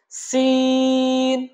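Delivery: সিন chanted with a long level vowel; elongated letter. Shown as a demonstration. Teacher asks students to repeat, সিন drawn out. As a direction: stretch the middle of সিন on one note. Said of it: "আমরা তিন আলিফ টানবো".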